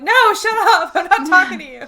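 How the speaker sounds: loud, high retold outburst at a phone; high-pitched exasperated shout; exasperated yelling. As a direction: yell it down in mock fury.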